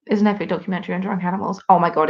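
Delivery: ordinary talk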